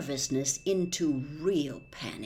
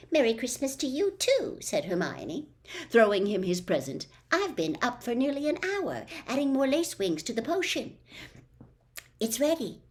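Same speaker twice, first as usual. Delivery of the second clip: put-on higher, a little quicker girl's voice; eager schoolgirl, lightly done; lightly voiced Hermione; 6 semitones higher than usual. Narrator voicing Hermione.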